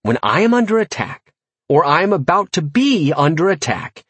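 high, emphatic orders; angry. A narrator's reading. Narrator giving his imp orders about being attacked, angry. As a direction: spell out the order angrily. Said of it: "I said angrily".

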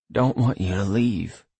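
ordinary delivery

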